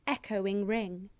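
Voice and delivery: normal talking voice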